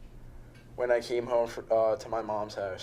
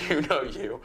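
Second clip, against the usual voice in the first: words breaking into a laugh; can't get it out without laughing. Real, not acted. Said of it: "I can't even say it without laughing".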